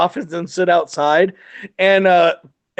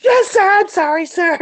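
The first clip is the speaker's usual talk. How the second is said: high, squeaky mock apology; cowed apology; 11 semitones higher than usual. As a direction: imitate a terrified subordinate caving. Scared subordinate apologizing after a chewing-out.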